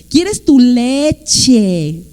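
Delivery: very slow, exaggerated LECHE; funny parent talk. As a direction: stretch "leche" out for a toddler to copy. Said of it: "hablamos chistoso con ellos". (papá hablando chistoso, "leche" bien alargado)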